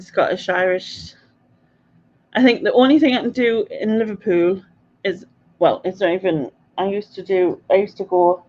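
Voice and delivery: normal talking voice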